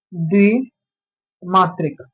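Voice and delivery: "द्विमात्रिक" stretched out slowly, syllable by syllable; drawn-out term. Shown as a demonstration. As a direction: spell out द्विमात्रिक slowly for the students. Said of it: "क्या बोलते हैं?". ("द्विमात्रिक" को खींचकर धीरे-धीरे बोलना)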